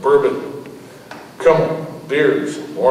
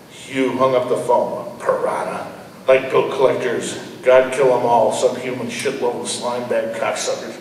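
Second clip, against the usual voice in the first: usual speaking voice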